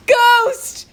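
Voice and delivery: shrill, very high, loud scream of panic; terrified scream. A narrator's reading. The boy screams as he flees the graveyard, panicked scream. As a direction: scream as if the ghost is right there.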